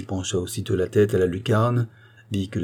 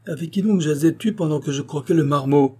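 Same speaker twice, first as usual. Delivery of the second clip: raised, blustering man's voice; gruff big voice; 7 semitones higher, 3 dB louder than usual. A narrator's reading. Visitor questioning the girl about her bolted door, gruff and blustering. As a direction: scold her in a high, heated tone. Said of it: "de sa grosse voix".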